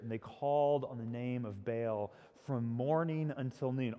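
ordinary talk

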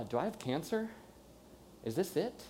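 soft, hesitant private worry; fearful inner questioning. Retold or imitated. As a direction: quietly ask yourself the dreaded question. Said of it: "dealing with that fear".

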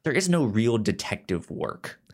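ordinary talk

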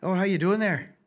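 high, loud attempt at an Irish greeting; Irish accent. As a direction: put on a rough Irish brogue. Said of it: "Pure, perfect Irish accent. Way better than that".

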